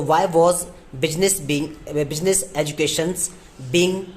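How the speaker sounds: slow, word-by-word dictation. Shown as a demonstration. Teacher dictating the sentence to students, slowly. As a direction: spell it out word by word for the class. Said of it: "dictating the sentence".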